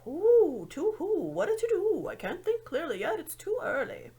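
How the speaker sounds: lightly voiced high owl muttering to itself. A narrator's reading. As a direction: grumble along as a drowsy owl. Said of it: "muttering to itself".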